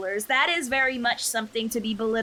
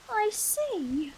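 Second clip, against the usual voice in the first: sister character voice; 13 semitones higher than usual. Acted. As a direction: voice the stern but caring sister, pitched up.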